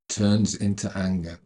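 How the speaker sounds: ordinary talk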